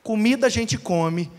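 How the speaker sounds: ordinary delivery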